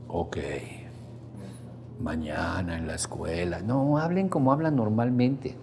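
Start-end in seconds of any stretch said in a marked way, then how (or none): 0.1-3.6 soft, low, hollow solemn murmur; solemn hush. Shown as a demonstration. Parent speaks to sleeping child in solemn hushed tone. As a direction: intone it like a grave ritual. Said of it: "con una voz muy solemne".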